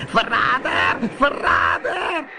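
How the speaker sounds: very high, slow furious witch shriek; shrieking with rage. A narrator's reading. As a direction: screech it as a betrayed witch. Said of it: "De zeeheks krijste van woede".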